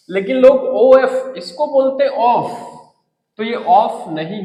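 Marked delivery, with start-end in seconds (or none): 2.1-2.5 "ऑफ" ending in an f sound; common mispronunciation. Shown as a demonstration. Teacher quotes what people say, mispronounced "ऑफ" with f. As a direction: end "ऑफ" on f, as many learners do. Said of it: "इसका उच्चारण गलत होता है".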